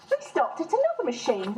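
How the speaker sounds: plain reading voice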